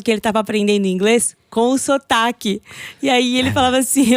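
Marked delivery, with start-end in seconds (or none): none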